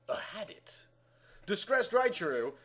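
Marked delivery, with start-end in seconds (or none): 0.1-0.9 soft eerie whisper; ominous whisper. A narrator's reading. Narrator hinting the dream was real, a whisper. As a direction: breathe the question like a spooky twist.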